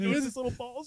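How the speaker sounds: high, soft silly voice; hammed-up high gag line; mock squeaky voice. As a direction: squeak it like a sly joke. Quoting movie gag voice.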